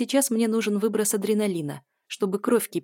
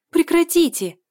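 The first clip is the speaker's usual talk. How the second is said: very high, loud pleading cry; begging scream; 11 semitones higher, 9 dB louder than usual. A narrator's reading. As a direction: beg them to stop at full voice. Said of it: "умоляет Лиза".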